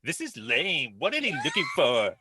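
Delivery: hammed-up teen daughter voice; whiny daughter voice. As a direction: do the sulky daughter. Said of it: "Do the voice".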